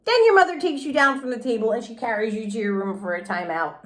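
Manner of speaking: plain reading voice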